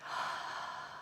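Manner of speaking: exasperated sigh at her partner; long sigh. Retold or imitated. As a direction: let out a long defeated sigh. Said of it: "And I was like".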